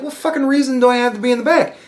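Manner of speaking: high, quick incredulous question; indignant protest. Retold or imitated. Asked whether he has been in the back, high indignant protest. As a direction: rattle it out high, mildly affronted.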